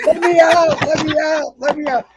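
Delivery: high, loud pleading cry; kid pleading. Retold or imitated. Locked in the locker, begging pranksters, high pleading cry. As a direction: plead like a trapped child. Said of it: "I'm like a kid banging on the door".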